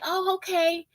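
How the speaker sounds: high, soft polite reply; meek reply. Retold or imitated. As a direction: answer meekly, caught off guard. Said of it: "I would just be like".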